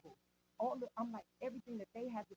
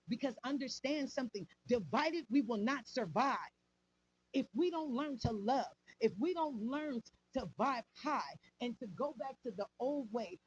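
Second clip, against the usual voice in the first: louder, building sermon-style exhortation; preaching; 5 dB louder than usual. Real, not acted. Rallying his people to unite, preaching louder. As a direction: preach it hard to the crowd, pushing each line.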